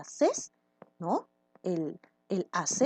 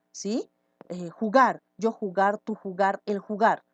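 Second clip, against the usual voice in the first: normal talking voice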